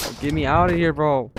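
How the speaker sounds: usual speaking voice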